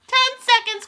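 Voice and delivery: very high, loud witch call; ringing, imperious shout; loud countdown cry. A narrator's reading. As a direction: cry the time out sharply. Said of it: "cried the Grand High Witch".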